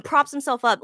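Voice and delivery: ordinary delivery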